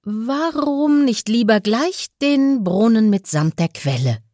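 hoch und keck angeberisch gefragt; „Warum“ hoch ansetzen, frech auftrumpfend; prahlerisch. A narrator's reading. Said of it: "fragte der Prahlhans". (prahlerisch und hoch)

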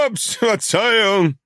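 hoch und langsam, gespielt betrunken; gelallt. A narrator's reading. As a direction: lall es wie ein Betrunkener. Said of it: "lallte er". (gespielt betrunkenes Lallen)

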